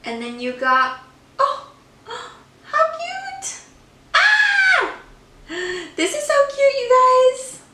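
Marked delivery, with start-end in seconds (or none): 1.4-7.6 high, squealing delight with a little shout; excited squeal. Real, not acted. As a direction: gush over something adorable. Squealing over cute snack packaging.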